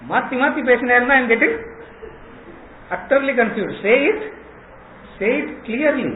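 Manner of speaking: lightly voiced, exasperated demand for clarity; confused Arjuna. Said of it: "only, Arjuna gets confused".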